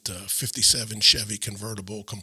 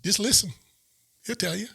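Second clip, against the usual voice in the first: high-pitched, urgent reassurance; retold eager advice; 8 semitones higher than usual. Excited friend tells him what to do next, eager.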